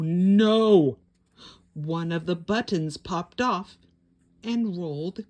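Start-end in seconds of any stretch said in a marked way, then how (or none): 0.0-1.0 loud shocked cry of dismay. A narrator's reading. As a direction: gasp it out to the kids. Button pops off.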